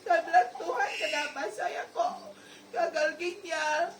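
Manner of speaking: loud, tearful cry to God. Real, not acted. Sick woman crying to God about her kidney failure. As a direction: sob the question out to God.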